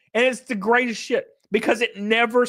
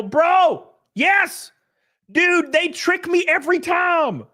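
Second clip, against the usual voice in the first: sudden high happy shouts; excited outburst; 8 semitones higher, slower than usual. Real, not acted. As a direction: jump on it with joy. Delighted reaction to chat.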